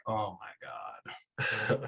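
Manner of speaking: very slow, hushed oh my God; awed dread. Retold or imitated. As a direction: drag it out in quiet dismay. Overwhelmed seeing the parking lot.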